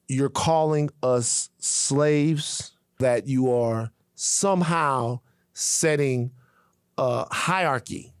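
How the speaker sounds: slow, weighty warning. Real, not acted. Grave warning, slowing down.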